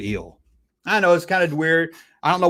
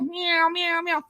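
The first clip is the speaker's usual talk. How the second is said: high playful meowing; imitating a cat, high and soft; mock cat meows; 14 semitones higher, 7 dB quieter, slower than usual. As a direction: meow like a cartoon cat. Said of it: "they make, like, a cat noise".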